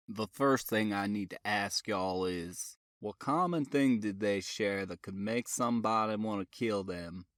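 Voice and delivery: a calm, apologetic detective; deep-voiced sheriff type, polite; southern detective. A narrator's reading. Boone's voice.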